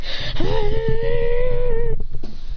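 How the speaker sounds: long high strained yell; held high cry while cutting through; drawn-out effort scream; slicing battle cry. Acted.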